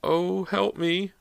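high, slow, weak plea; murmured plea. A narrator's reading. Injured mother staggering toward her daughter, murmured plea. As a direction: plead slowly in a high voice. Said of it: "Missus Fraser murmured".

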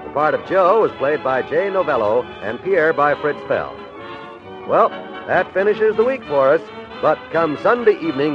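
usual speaking voice

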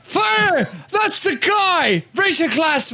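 high, rallying call; rally the drinkers; rousing shout. Acted. Recruit answering a heckler in his toast, rousing shout. Player rallies the crowd.

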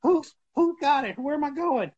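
put-on high, flustered old-timer voice; high panicky mock old man; confused old drivers. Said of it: "All the drivers are".

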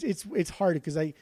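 ordinary delivery

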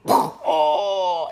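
hammed-up wounded yelp; mock cry of pain. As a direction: groan as if just shot. Bullet hits Valentín.

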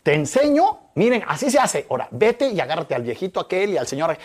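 high and loud, eager instructions to the group; excited teaching call. Retold or imitated. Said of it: "Y le digo".

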